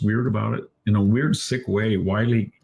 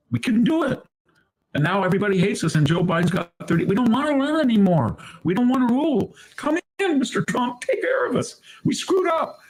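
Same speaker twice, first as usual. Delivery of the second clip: high, pleading mock voice of the left, rising higher; hammed-up whimper of giving up, pitched way up; whiny mock left; 13 semitones higher than usual. Mocking the left begging Trump to take over, in a high whine. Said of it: "and I said".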